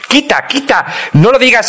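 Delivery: high, impatient quita quita; a playful impatient friend; mock exasperated friend. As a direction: shoo him off in exasperation. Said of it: "me decía".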